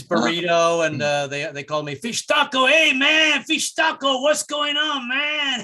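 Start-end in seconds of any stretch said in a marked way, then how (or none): 2.7-5.6 high-pitched stoner greeting, drawn out at the end; hammed-up stoner voice, pitched way up. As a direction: call out like a happily stoned buddy. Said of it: "their relatives are [name] and [name]".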